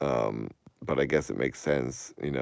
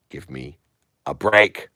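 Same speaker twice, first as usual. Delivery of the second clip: exasperated; 5 dB louder than usual. Real, not acted. Exasperated outburst.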